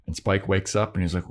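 ordinary delivery

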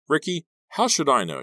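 high, loud irritated retort; sharp, fed-up comeback; snapping. A narrator's reading. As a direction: snap back in exasperation. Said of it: "she snapped".

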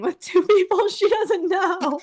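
high, loud, breaking into laughs; laughing quote. Retold or imitated. Quoting Mom about the strangers' wedding, laughing loudly. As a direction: quote Mom while cracking up. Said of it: "she goes".

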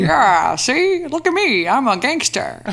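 high, brash hammed-up mobster; old-timey gangster voice. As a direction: strut it like a cartoon gangster. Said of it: "old timey gangster voice".

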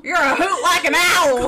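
loud mock Southern saying; hammed-up Southern folksiness; Southern accent. Podcaster mocking how Southern people talk, hammed-up Southern accent. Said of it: "whatever the fuck Southern people say".

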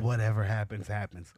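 mock instructor murmur. Mimics the instructor's ear whisper.

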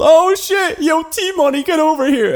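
excited yell. Retold or imitated. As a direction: yell it out, thrilled. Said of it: "and he yelled".

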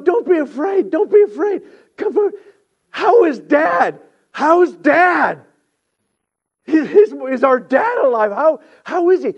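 very high, loud, emotional plea; urgent pleading. Acted. Joseph asking his brothers about Dad, urgent pleading. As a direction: cry out as if desperate for news.

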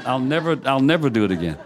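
ordinary delivery

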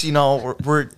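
ordinary delivery

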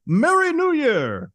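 loud, high, drawn-out festive cry; high-pitched put-on holiday whoop; exclaimed mock greeting. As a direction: exclaim it big, stretching it slowly.

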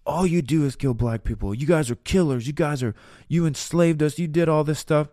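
imitating an outraged bystander; angry kids yelling. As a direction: throw it back as an angry teen. Angry kids shouting at police.

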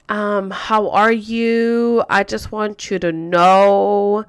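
very slow, drawn-out words; mock letter-reading. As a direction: stretch each word as if reciting a letter.

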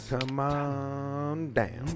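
drawn-out announcer call to the contestant; game show host. Acted. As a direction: stretch it out like a TV host calling a contestant.

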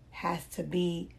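ordinary talk

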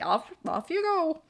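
high, sing-out mock Dumbledore; Dumbledore shooing. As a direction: send them off airily. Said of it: "Dumbledore shows up right then and there and is like".

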